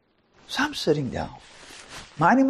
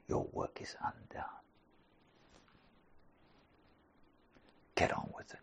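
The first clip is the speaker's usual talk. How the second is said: urgent whispered challenge, barely voiced; intense whisper; 19 dB quieter than usual. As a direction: breathe the words like a secret command. Urgent hushed opening to listeners.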